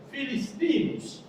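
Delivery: "filistinus" said slowly and carefully, stress on -tí-; phonetic Latin pronunciation. Shown as a demonstration. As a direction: sound out "filistinus" as the Romans said it. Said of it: "pronunciando fonéticamente".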